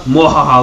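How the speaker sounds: short loud villain cackle; sneering panto villain. Pretend. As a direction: gloat with a quick evil laugh. Taunting America about its cover.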